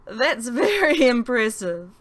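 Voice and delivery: loud, high, laughing delight; laughing loud high outburst; giddy laughing. Acted. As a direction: giggle through the words. Lolitha giggling after rescue.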